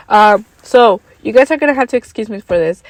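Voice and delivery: rough voice from getting sick. Real, not acted. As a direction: push through a sore throat. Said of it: "my voice is being very weird".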